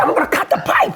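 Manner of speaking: high, worked-up declaration; heated threat. Retold or imitated. Homeowner threatening to cut the pipe, high heated shout.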